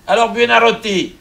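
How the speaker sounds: high, loud call out. Acted. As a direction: call his name loudly. Pope calling into the chapel.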